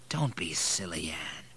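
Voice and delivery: soft, low-key gentle reassurance; kindly. A narrator's reading. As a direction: soothe a weeping friend softly. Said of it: "The kindness of his voice".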